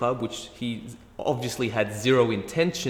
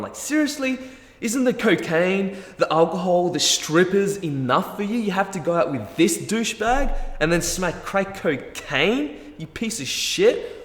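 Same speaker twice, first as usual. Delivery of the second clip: higher and louder, scolding himself in disbelief; self-berating rant; 8 semitones higher, 4 dB louder than usual. Retold or imitated. Hungover guy scolding himself in his head, disgusted shout. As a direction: tear into yourself like your own harsh critic.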